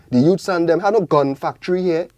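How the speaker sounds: ordinary talk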